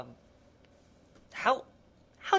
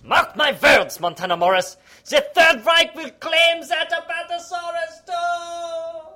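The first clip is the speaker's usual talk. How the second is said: villain's threat; 10 semitones higher than usual. Acted. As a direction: sing out a cartoon villain's vow.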